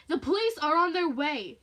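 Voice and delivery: forceful, alarmed threat; defiant warning. Acted. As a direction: raise your voice to warn off an intruder. Woman warns intruder loudly.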